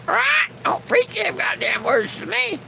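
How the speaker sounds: high, squawking parrot. Acted. Bird interrupting the preacher's sermon, squawky parrot voice. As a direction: screech it like a parrot repeating what it heard. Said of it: "all of a sudden that bird says".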